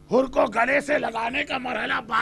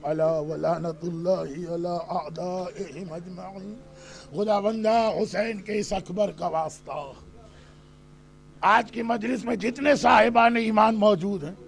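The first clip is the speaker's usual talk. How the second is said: softer, lower supplication after the sermon; closing prayer; 3 semitones lower, 4 dB quieter than usual. Acted. Preacher ends the majlis praying for the mourners, soft supplication. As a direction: plead to God quietly and earnestly.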